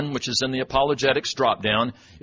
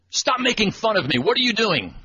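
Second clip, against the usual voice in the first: much higher, imitating an offended scold; custodian scolding; 9 semitones higher than usual. Custodian scolding the sub for mocking her, imitated indignation. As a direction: play her indignant and accusing. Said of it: "The voice goes".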